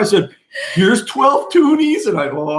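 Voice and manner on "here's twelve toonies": slow, high, talking down; condescending. Retold or imitated. Husband mocking the teller, slow patronizing count. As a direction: spell it out like to a child. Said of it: "I spoke down to her".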